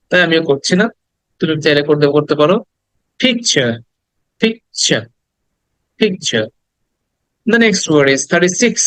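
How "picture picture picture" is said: "Picture" as PIK-cha, two clear parts; r-less British pronunciation. Shown as a demonstration. Teacher drilling picture British style, silent-r pronunciation. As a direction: leave the r off the end of "picture". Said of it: "লাস্টে আরটাকে ব্রিটিশে উচ্চারণ করবে না".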